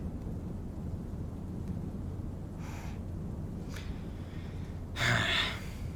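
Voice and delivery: very long, soft breath out; resigned sigh. Acted. Mentor giving in to the rookie, resigned sigh. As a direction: let out a drawn-out quiet sigh.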